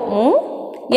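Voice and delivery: usual speaking voice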